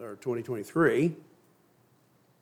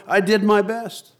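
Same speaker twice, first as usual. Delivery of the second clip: pleading; 8 semitones higher, 6 dB louder, slower than usual. Acted. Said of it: "cried Noah".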